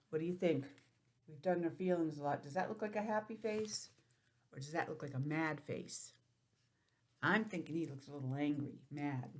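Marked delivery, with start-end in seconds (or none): none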